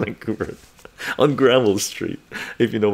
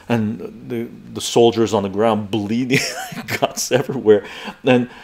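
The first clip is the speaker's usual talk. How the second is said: ordinary talk, nothing marked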